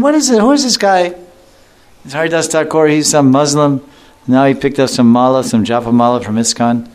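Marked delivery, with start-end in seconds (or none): 0.0-3.9 high, scornful jealous Brahmin; envious sneer. Retold or imitated. Envious Brahmin scoffing at the dancing saint, sneering high. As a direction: scoff at him like a jealous priest.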